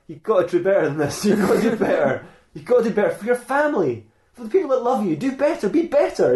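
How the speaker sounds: rising, high-pitched exhortation; earnest pep talk. Acted. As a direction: plead with him, pitch climbing to the end. Robot lecturing Optimus after killing Earl.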